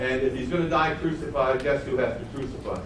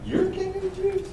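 much higher, scoffing question; sarcastic; 3 dB quieter than usual. Acted. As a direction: scoff at the very idea. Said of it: "one of those kinds of things".

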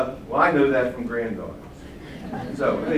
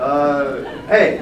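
ordinary talk

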